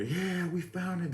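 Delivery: high, drawn-out mock cheer; imitated celebrating. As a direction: crow over the find as the officers. Retold officers celebrating the find.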